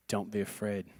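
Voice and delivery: low, soft reassuring voice; calm them like a shepherd; gentle Jesus. Pretend. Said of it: "he turns and goes".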